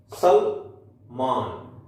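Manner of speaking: सलमान drawn out slowly with the ल only half-said. Shown as a demonstration. Teacher slowly models सलमान with a half ल for students. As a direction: stretch सलमान, clipping the ल. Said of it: "यहां ल को हम आधा बोलते हैं".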